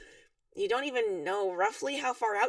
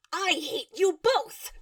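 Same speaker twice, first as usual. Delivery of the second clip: slow, drawn-out, indignant protest; loud insisting; 4 semitones higher, 4 dB louder, much slower than usual. A narrator's reading. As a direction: stretch out each word in mock outrage. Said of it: "Karkat insists loudly".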